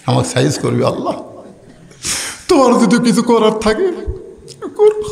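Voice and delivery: acted weeping plea, rising high; imitated crying supplication; tearful prayer. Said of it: "চোখের পানি নিয়ে একেবারে".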